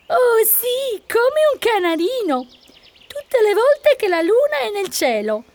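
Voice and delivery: very high-pitched cat voice; boasting cat. A narrator's reading. Cat tells donkey she sings like a canary, boasting. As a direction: boast in a squeaky feline voice.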